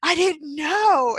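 high, slow, startled "oh"; embarrassed surprise. Retold or imitated. Neighbor caught out by the mom, slow startled embarrassment. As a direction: play the neighbor caught out, dragging it slowly.

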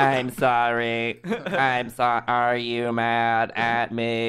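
slow, dopey robot voice; dumb robot voice. Pretend. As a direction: whine it like a sad little robot. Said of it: "A bunch of robo bingies".

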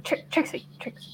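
urgent stage whisper to get attention; hissed under the breath, barely voiced; hushed aside. Player pulls Trixie aside mid-negotiation, hushed whisper. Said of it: "Can I, like, pull Trixie aside".

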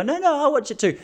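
high-pitched imitation of a woman; lightly mocking falsetto; mock female objection. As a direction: squeak it like a protesting girl.

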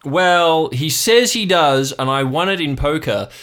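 high, loud uncle voice; blustering uncle. Acted. Uncle explains old Jim's debt to his nephew, high and blustering. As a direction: insist on a dubious story, loudly. Plays Uncle Marv on the phone.

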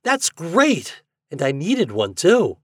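high, excited delight; thrilled exclamation. A narrator's reading. Reddy opens his prize box, exclaiming. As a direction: exclaim over a wonderful present. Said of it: "Reddy opened it and exclaimed".

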